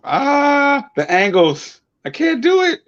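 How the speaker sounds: squeaky high frustrated yelps; straining exclamation. Real, not acted. Twisting arm to show camera tattoo, high strained yelp. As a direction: voice cracks up high struggling with angle.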